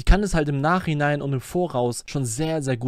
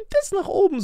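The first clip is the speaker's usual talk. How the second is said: rising emphasis demo. Shown as a demonstration. Streamer shows chat the singer's upward stress, with a high swoop. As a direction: voice jumps way up on "so" to show the stress going up. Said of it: "aber die Betonung".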